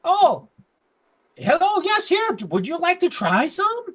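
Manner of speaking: very high, eager gnome voice; friendly gnome. Acted. Gnome greets a visitor with a treat, high friendly voice. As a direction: pipe up high, inviting a stranger. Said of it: "he's like".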